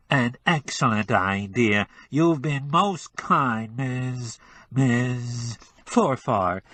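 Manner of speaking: slow, nasal disguised voice. Acted. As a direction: pinch the sound into the nose. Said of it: "with an adenoidal twang".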